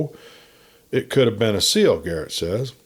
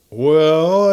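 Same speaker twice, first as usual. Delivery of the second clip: higher, drawn-out sly well; 6 semitones higher, 6 dB louder than usual. A narrator's reading. Narrator teasing Dale, sly and knowing. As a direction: set up the joke slyly. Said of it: "I said slyly".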